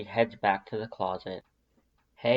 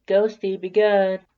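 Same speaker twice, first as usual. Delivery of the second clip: louder, higher call across the house; calling to the cat; 9 semitones higher, 9 dB louder than usual. A narrator's reading. To Ghosty the cat before leaving, a loud higher call.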